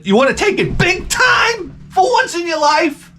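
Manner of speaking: very high, straining shout; hyped-up exhortation. Acted. Comic urges the league to think big, high excited shout. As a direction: holler it like a fired-up pitchman.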